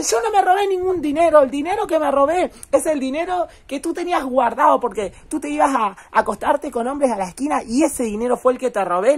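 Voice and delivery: angry retort. Retold or imitated. A son yelling back at his accusing mother, angry. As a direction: throw it back at her in anger. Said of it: "Y le dice".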